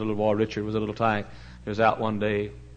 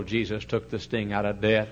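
ordinary talk

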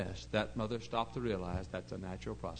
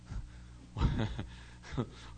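breathy chuckle; 3 dB quieter than usual. Real, not acted. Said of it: "I'm sorry to laugh".

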